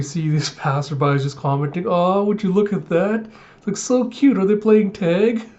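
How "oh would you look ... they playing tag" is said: high, gushing onlooker voice; high sweet gushing over something cute; cooing passersby. Retold or imitated. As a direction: coo it like a charmed bystander.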